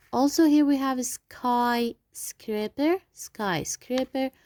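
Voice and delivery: Skyscraper said slowly, y as long i; slow, careful skyscraper. Shown as a demonstration. Teacher slowly models skyscraper for pupils. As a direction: draw out Skyscraper syllable by syllable. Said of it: "We will pronounce Y I".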